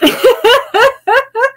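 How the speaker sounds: high rising laugh; a real laugh bubbling up high. Real, not acted. Laughing about her accent fading, high laugh.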